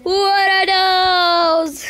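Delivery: slow, loud drawn-out meme yell; meme shout. Pretend. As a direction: stretch it out like the meme.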